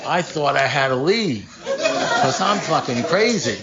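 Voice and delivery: slow, laughing through quoted relief. Retold or imitated. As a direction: let the relief spill out in laughter.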